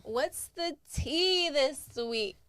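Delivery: slow, drawn-out, high "What's the tea this week?"; signature podcast greeting. Acted. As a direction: stretch out "tea" playfully. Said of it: "I love the way you say it".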